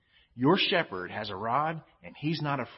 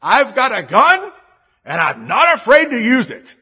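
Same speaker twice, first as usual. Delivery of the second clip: loud, high mock tough-guy threat; hammed-up dad shouting a warning; dad's gun threat; 12 semitones higher, 18 dB louder than usual. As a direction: bellow it like a dad defending the house. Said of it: "And he says this".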